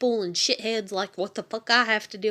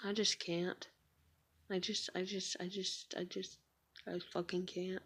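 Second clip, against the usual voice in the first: real, much softer, almost gone; fading out; 2 semitones lower, 16 dB quieter than usual. Real, not acted. Exhausted mother giving up mid-sentence, real fading voice. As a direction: let each try fade to nothing.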